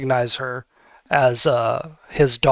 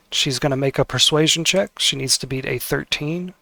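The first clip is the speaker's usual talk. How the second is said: ordinary talk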